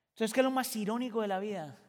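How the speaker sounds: usual speaking voice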